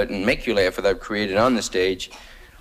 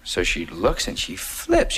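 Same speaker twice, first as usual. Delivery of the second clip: normal talking voice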